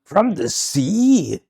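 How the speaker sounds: drawn-out, high incredulous echo; mock disbelief. Echoing co-host's line in mock disbelief. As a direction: stretch it out high, aghast.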